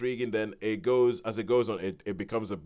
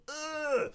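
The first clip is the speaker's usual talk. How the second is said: mock groan of disgust. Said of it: "you're like".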